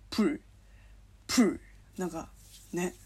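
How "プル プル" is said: "プル" with its P edging towards B; voicing mixed in. Shown as a demonstration. Coaching listeners on Korean, "プル" with some voicing mixed into the P. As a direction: say "プル" between pu and bu, twice. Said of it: "濁音混ぜるとやりやすいかも".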